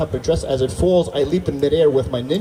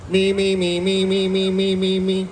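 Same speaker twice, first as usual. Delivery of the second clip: higher droning note, repeated evenly; monotone rapid list; 4 semitones higher, slower than usual. Retold or imitated. Scrolling further through his own messages, monotone repetition. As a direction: rattle it off on one unchanging pitch.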